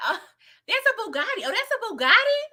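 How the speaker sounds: mock-dazzled squeal at a fancy car; impressed gasp. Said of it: "She was like".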